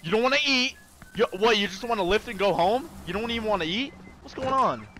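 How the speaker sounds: loud, high exasperated questions; baffled coach pushing his player. Acted. Pleading with game character.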